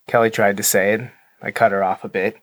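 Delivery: ordinary talk, nothing marked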